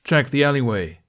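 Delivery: loud, commanding call; barked order. Acted. Captain ordering his squad to search, a loud command. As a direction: bark the order at the guards. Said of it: "ordered the captain".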